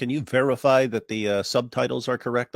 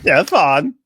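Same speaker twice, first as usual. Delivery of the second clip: mocking; 6 semitones higher, 10 dB louder than usual. Teasing repeat of the name.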